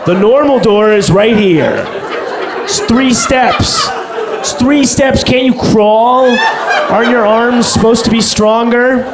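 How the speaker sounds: hammed-up griping, high and drawn out; mock exasperated complaint. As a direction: protest as if hugely inconvenienced. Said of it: "Would you be like".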